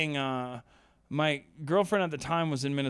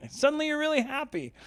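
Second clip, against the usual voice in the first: much higher, giddy and laughing; mock giddy high; 12 semitones higher than usual. Shown as a demonstration. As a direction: squeal it like sudden bliss.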